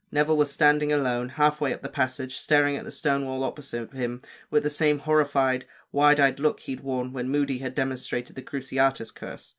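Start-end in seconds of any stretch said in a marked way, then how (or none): none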